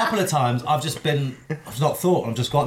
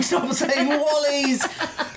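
laughing protest; 10 semitones higher, much slower than usual. Retold or imitated. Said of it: "I'm like".

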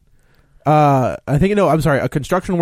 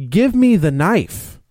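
stern cop demand; 8 semitones higher, much slower than usual. Retold or imitated. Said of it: "And the other cop's like".

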